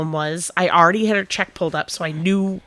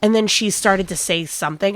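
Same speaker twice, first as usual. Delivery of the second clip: ordinary talk, nothing marked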